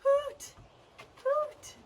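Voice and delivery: high hooting owl calls; owl hoots. Shown as a demonstration. Teacher hooting like an owl for the kids. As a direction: call out like an owl. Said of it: "Let's fly like an owl".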